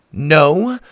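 high, loud defiant yell; girl defying the captain. Acted. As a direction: shout back at the pirate. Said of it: "Susie yelled".

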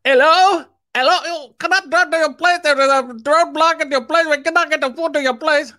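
high, pleading driver voice well above his own; flustered delivery driver. Retold or imitated. Delivery driver calling the customer, high and flustered. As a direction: play a panicky caller stuck at a roadblock. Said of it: "and he'd be".

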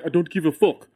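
lightly mimicking a friend's speech; a hint of a mocking impression. Comedian quoting his co-host's callous reply, mild mimicry. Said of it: "everybody who does an impression of me".

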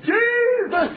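high shouted praise; congregation shouting. Retold or imitated. Worshipers shouting praise in the noisy church. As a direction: cry out to Jesus. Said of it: "They all saying".

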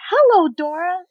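loud, high, gushing hello; imitating an over-eager stranger; overbearing greeting. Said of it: "she comes marching".

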